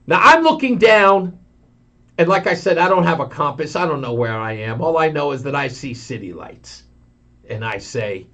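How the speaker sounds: usual speaking voice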